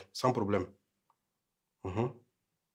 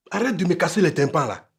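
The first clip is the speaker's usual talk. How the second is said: angry outburst, louder and higher; agacement; 5 semitones higher, 5 dB louder than usual. Acted. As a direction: tell the nagging wife off, voice raised. Husband snaps in a quarrel. (agacé, en haussant le ton)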